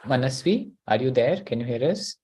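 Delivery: usual speaking voice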